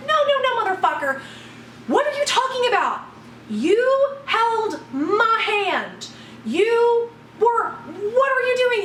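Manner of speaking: high, outraged retold tirade; livid yelling. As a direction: yell in indignation without full volume. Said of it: "I was yelling at him".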